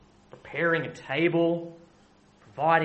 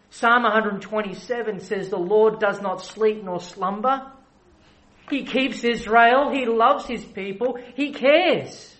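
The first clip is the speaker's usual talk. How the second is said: louder and higher to a peak on "cares"; rising preaching climax; 5 semitones higher, 6 dB louder than usual. Acted. Preacher proclaiming God's care, building to full voice.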